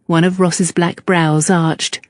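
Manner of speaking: usual speaking voice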